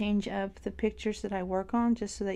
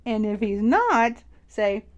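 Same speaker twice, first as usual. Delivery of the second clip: excited louder instructions; 2 semitones higher, 6 dB louder than usual. Retold or imitated. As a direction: hype it up like an eager fan mom. Mom coaching daughter, getting louder.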